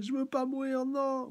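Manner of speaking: put-on very high, thin child voice begging; little girl pleading. Little girl begging not to die, very high voice.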